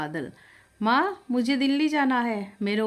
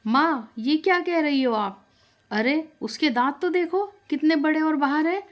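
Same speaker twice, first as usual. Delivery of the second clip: shocked protest; 6 semitones higher, 4 dB louder than usual. A narrator's reading. Son Badal objects to mother.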